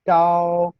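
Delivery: "gao" high, level and sustained, loud; first-tone demonstration. Shown as a demonstration. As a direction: hold "gao" on one high note. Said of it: "Aparece el primer tono". ("gao" en primer tono, alto y sostenido)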